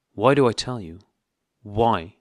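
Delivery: loud, indignant retort; angry. A narrator's reading. Husband snapping back at his wife, loud and angry. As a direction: throw her question back at her hotly. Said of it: "he went on just as angrily".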